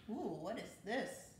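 plain reading voice

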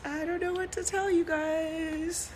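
thin, high, soft tearful wail; real crying. Real, not acted. Woman at a loss before her fans, crying. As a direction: voice climbs and shrinks as tears take over.

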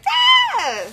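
high delighted shriek; giddy shriek. Shown as a demonstration. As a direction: squeal with excitement. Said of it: "I'm just like".

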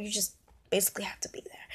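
whispered aside. Real, not acted. Aside to viewers.

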